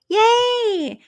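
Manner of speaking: high cheerful squeal of thanks; excited yay. Real, not acted. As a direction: squeal with joy at the gift. Cheering a super chat.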